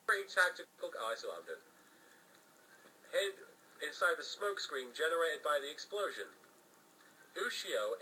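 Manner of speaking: ordinary delivery, nothing marked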